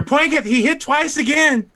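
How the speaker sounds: high, excited shout-out to the commentator; gleeful commentator-style callout; excited announcer call. Acted. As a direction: crow it like a hype man. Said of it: "for our commentator".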